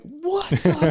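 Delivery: very high, hyped streamer greeting; Jowo's YouTuber voice. Retold or imitated. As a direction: sing it out like a streamer intro. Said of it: "it's like a cadence".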